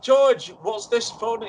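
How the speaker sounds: high, loud, astonished greeting; startled surprise. Acted. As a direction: blurt it out, amazed the caller is there. Startled when late guest rings.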